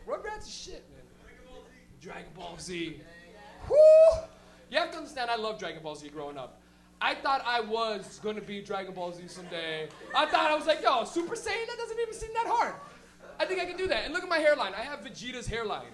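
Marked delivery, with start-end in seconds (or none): 3.7-4.2 high, loud "whoo" cheer; excited whoop. Real, not acted. Excited cheer for Dragon Ball Z. 10.8-14.0 lightly voiced confident kid, pitched up; eager younger self. Acting his naive younger self.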